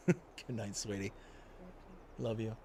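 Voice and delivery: soft, low goodnight to a partner; hushed, loving sign-off; tender goodnight. Real, not acted. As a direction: say it quietly, aside and affectionately. Off-mic goodnight to his wife.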